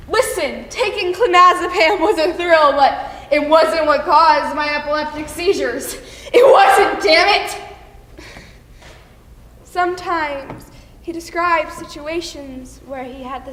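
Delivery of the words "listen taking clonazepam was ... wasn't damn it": loud, heated denial building to a shout; angry outburst. Acted. Husband telling his wife the drugs weren't to blame, angry. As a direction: argue forcefully, exploding on the last words.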